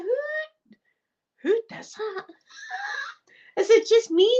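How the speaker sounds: very high, mock-appalled question; hammed-up high-pitched bafflement; incredulous squeal. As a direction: act out squeaky disbelief. Incredulous inner reaction, retold.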